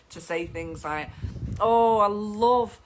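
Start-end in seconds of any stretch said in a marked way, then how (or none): 1.6-2.8 gushing. Acted. Photographer enthuses to client.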